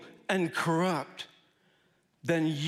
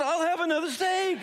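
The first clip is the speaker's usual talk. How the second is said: high, excited mock order; eager acceptance; 11 semitones higher, 3 dB louder than usual. Gives in eagerly to the waiter.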